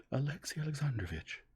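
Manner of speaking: high, loud urgent address; desperate determination. A narrator's reading. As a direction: call his name, desperate and set. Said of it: "with desperate determination".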